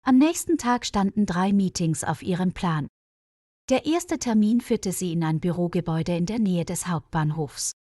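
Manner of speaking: ordinary talk, nothing marked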